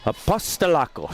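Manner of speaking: Apostolakos with a playful Greek accent. Shown as a demonstration. Co-host asks her to keep the accent, mock Greek accent. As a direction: give Apostolakos a hammed-up Greek flair. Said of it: "I think you need to keep doing the accent".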